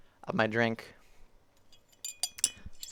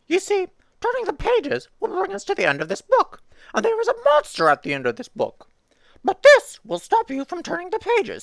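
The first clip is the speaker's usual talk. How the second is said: very high and much louder, alarmed puppet; Grover voice; 7 dB louder than usual. Acted. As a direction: scold the reader in a frantic high squeak. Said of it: "tried to do the Grover voice".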